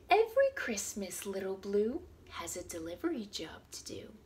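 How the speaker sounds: lively storybook read-aloud; reading to children. Acted. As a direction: read it out to little kids. Reads picture book to children.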